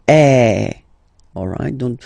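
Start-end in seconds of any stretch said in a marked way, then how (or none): 0.1-0.7 loud open eh, mouth wide; open French è. Shown as a demonstration. Teacher repeating accent grave sound for class. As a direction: drop the jaw and say eh. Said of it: "so it goes like".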